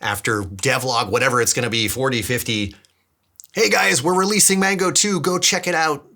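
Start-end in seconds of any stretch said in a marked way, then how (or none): 3.5-6.1 much higher, bright hype-up for viewers; excited announcement. Pretend. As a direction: announce the release like a proud launch day. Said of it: "I get really excited about the day I can say on here".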